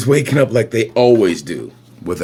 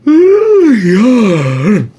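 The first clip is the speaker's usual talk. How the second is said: yawn; 8 semitones higher, 6 dB louder than usual. Acted. Lets out a big yawn.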